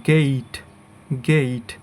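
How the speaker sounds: গেইট with a long ei glide; correct pronunciation. Shown as a demonstration. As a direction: say গেইট as "geit", e sliding into i. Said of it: "এরপর এটার উচ্চারণ হবে".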